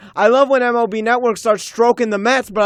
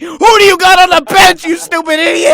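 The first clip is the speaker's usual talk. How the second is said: higher and louder, insulting yell; angry yell; 6 semitones higher, 4 dB louder than usual. Real, not acted. Yelling insult at manager.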